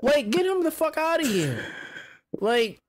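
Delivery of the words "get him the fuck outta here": high indignant dismissal; retold angry demand. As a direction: demand the player be thrown out. Retold demand to kick player.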